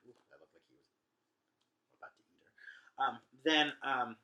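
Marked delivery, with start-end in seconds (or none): 0.1-3.0 slow, hushed gossip under the breath; a secret remark to nobody; whispered aside. Real, not acted. Teacher whispers an aside about a kissing couple.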